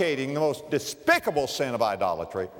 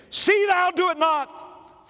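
high, slow commanding angel voice; angel's rebuke; 4 dB louder, slower than usual. Acted. Preacher voicing the angel forbidding John, high and commanding.

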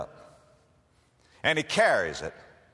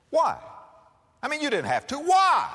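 much higher, louder pleading question; bewildered why; 12 semitones higher, 5 dB louder than usual. Acted. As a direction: demand to understand. Soldier presses the question.

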